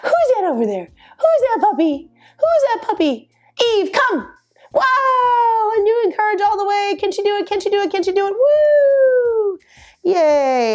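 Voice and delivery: squeaky, excited puppy voice; high-pitched puppy talk. Shown as a demonstration. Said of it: "I'm gonna tease her for a second".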